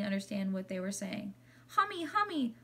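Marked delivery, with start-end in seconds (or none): 1.7-2.5 put-on high, sing-out little toddler voice; little child calling. A narrator's reading. Toddler calls to the new class hamster at the door, little high voice. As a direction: call out in a tiny high voice. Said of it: "a little voice called out".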